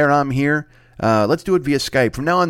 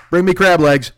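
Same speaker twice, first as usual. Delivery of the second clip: loud, higher royal command; commanding king; 7 semitones higher, 6 dB louder than usual. Acted. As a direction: boom out an order like a king. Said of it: "you're like a king".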